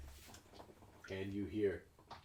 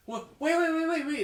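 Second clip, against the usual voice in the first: high, loud hurried wait wait; urgent calling; 10 dB louder than usual. Acted. Cook stopping the guest from leaving, high loud urgent calls. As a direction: call him back urgently, pitch way up. Jenks calling him back.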